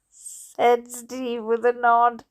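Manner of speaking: ordinary delivery, nothing marked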